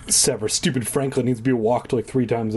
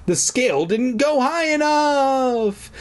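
mock dramatic; 11 semitones higher, slower than usual.

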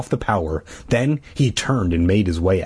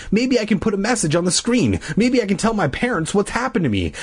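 excited; 10 semitones higher than usual. A narrator's reading. Bee excited about computer plan.

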